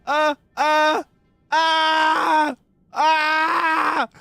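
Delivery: high loud rhythmic ah cries; straining cries. Real, not acted. Streamer holding a stare, crying out high and loud. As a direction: pant out high ahs of strain. Refusing to blink, eyes streaming.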